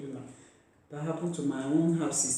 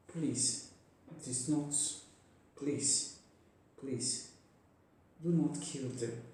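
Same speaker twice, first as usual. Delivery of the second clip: very soft, grief-worn begging; hushed, sorrowful plea; subdued pleading; 8 dB quieter, slower than usual. Real, not acted. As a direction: beg softly with long pauses. Tearful plea against killing women.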